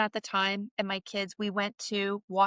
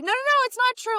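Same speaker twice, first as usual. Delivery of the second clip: mock protest; 4 dB louder, faster than usual. Retelling Vicky's protest.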